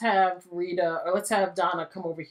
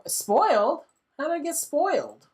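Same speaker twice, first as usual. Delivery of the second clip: indignant surprise; 8 semitones higher than usual. Real, not acted. Food spoiled, indignant.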